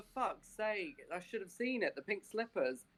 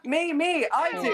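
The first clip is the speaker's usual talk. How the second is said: put-on eager kid; 9 semitones higher, 8 dB louder than usual. Eager child character.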